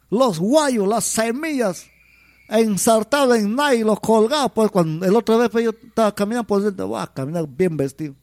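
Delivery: usual speaking voice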